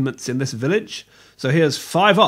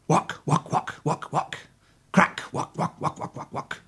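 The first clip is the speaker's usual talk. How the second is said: whispered footstep sounds; 5 dB quieter than usual. Acted. Footsteps voiced as sound effect.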